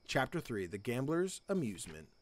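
low, quiet title announcement; quiet book-style chapter heading; chapter title read. Acted.

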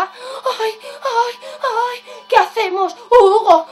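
high, anxious little pig voice; fretting piglets. Acted. Piglets unsure whether to open, fretting. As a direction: dither in a high worried voice. Piglets fret about opening the door.

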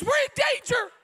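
high shouted alarm, repeated; hammed-up panic shout; mock alarmed lady. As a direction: cry out the danger like a frightened woman. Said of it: "Another lady says".

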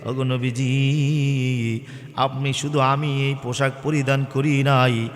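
ordinary talk, nothing marked

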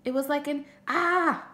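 high, loud mock scream at the end; child showing off how he screamed; demonstrated scream. Jamie demonstrates his scream.

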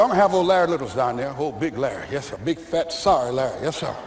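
a hint of the stern coach; lightly voiced coach. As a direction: growl it low like an old coach.

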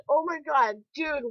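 usual speaking voice

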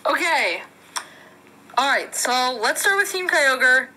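usual speaking voice

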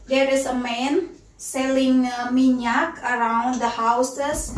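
ordinary talk, nothing marked

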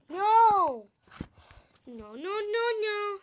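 loud, high no's; exasperated outburst; real frustration. Real, not acted. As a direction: protest in frustration. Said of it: "I am so mad".

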